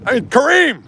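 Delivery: high, loud, disbelieving cry of his name; exasperated outburst. Real, not acted. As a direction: burst out in indignant disbelief. Outraged defense of Kareem.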